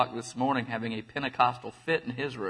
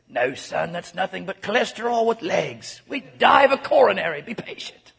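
mock British father, rising higher and louder; British accent; 7 semitones higher, 4 dB louder than usual. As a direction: build up in a hammed-up British accent, then hush. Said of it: "well, he's British".

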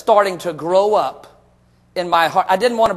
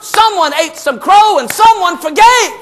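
raised pitch, loud and punched; emphatic preaching shout; 9 semitones higher, 5 dB louder, slower than usual. Real, not acted. Preacher telling how father and son made peace, emphatic shout. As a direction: proclaim it to the whole congregation. Preacher driving home the reconciliation.

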